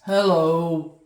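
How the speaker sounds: a lightly voiced gruff polar bear, loud; a hint of a grumpy old bear; grumpy polar bear greeting loudly. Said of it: "says a gruff, grisly voice".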